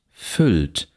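"füllt" with a short open ü; short lax vowel. Shown as a demonstration. Lecturer reads minimal pairs, short lax vowel. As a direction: keep the ü in "füllt" brief and lax.

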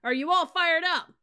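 rally the troops at full volume; drill yell. Acted. Sergeant yelling to fire up his cadets. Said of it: "yelled back".

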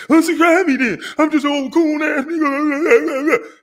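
high, slow mock of an upset rival; mocking upset YouTuber. Mocking a YouTuber who got upset on video, in a high, slow put-on voice. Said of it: "you was upset".

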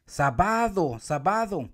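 "sábado" called as sa-BA-do, wrongly stressed; stress on middle syllable. Shown as a demonstration. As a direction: push the ba in sábado. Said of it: "gritar en los tres lugares".

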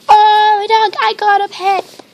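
high, loud burst of delight; real thrilled outburst; excited squeal. Real, not acted. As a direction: squeal out the good news. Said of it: "so excited".

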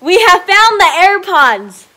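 slow, booming celebration; triumphant announcement. Real, not acted. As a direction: proclaim great news to everyone. Announces lost AirPods found.

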